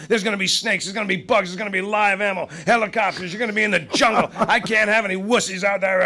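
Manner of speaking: hammed-up, relentless harangue; retold tirade. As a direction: pile up the warnings like a ranting director. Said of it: "he's given me this tirade".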